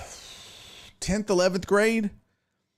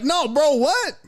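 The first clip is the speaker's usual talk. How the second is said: mock disbelief; 7 semitones higher, 4 dB louder, slower than usual. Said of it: "He's like".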